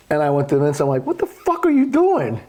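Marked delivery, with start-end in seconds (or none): 1.1-2.4 retold high-pitched, incredulous demand; acting out appalled exasperation, voice jumping up; exasperated outburst. Pro confronting Vince over the bullet holes, exasperated.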